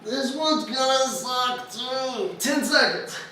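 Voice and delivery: strained, mid-plank effort; talking through the strain of a plank; holding a plank. Real, not acted. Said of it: "My abs are burning".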